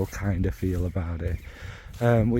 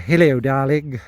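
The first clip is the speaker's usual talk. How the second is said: mock New Zealand accent, a bit louder; 6 dB louder than usual. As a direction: greet like a New Zealander, hammed up. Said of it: "That's my New Zealand accent".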